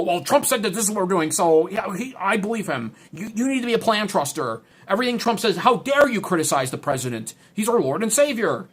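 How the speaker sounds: indignant zealot caricature; mocking cultist. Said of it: "The maga cultists go".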